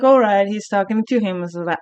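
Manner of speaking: ordinary talk, nothing marked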